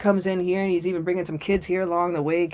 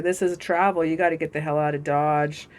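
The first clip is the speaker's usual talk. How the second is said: ordinary talk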